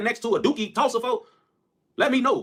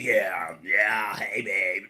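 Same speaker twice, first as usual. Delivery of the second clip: very low, laid-back mock voice; smooth deep talker; much slower than usual. Talking to a girl in a very low mock voice. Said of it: "You talk to a bitch".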